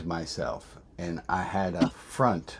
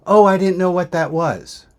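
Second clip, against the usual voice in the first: daughter's voice; 11 semitones higher, 12 dB louder than usual. Retold or imitated. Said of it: "And she goes".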